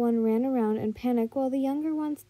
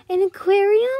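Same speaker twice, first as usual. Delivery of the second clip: high, loud delighted question; gleeful surprise; 8 semitones higher, 8 dB louder than usual. A narrator's reading. Little girl pointing at the building, gleeful surprise. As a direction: squeal it upward with glee. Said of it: "widening with glee".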